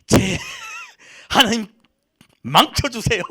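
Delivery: high, loud mock plea, half laughing; acted venting prayer. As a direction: cry out a hammed-up complaint to God. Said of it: "뭐라고 하소연".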